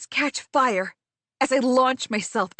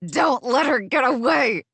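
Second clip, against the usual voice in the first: screech; 3 dB louder than usual. Acted. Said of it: "Ariadna screeches".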